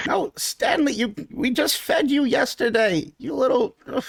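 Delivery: high, scolding the squid pet; scolding shopkeeper. Acted. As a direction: chide the greedy squid. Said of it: "he's like".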